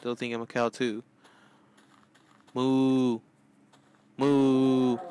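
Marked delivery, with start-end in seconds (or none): 2.5-5.0 mock moo, louder; hammed-up cow lowing. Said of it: "maybe if I sound like, uh, a cow".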